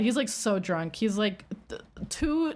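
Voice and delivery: ordinary delivery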